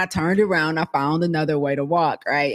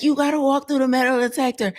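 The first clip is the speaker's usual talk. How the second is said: mock security guard; 9 semitones higher than usual. Guard voiced in retold story.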